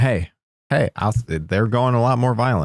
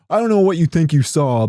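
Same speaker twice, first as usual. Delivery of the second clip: mock defensive boyfriend; 8 semitones higher, 4 dB louder than usual. Boyfriend gaslighting his girlfriend.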